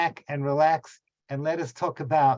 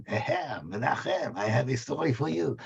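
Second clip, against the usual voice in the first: put-on pathetic French accent. Rabbi imitating his French neighbour's greeting in a mock French accent. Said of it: "my pathetic French accent".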